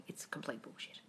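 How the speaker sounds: ordinary talk, nothing marked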